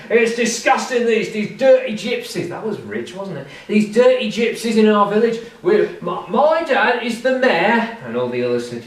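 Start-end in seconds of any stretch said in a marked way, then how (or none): 0.1-2.5 put-on drunken loudmouth. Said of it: "he's standing on the table and he's saying". 3.7-8.0 pompous mayor's son. Retold or imitated. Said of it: "he's saying".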